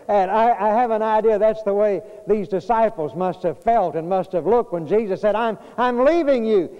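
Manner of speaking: high, loud climax of a sermon; full-force preaching. Acted. Pastor comparing his father to the disciples, preaching loud. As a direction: build it to a ringing pulpit peak.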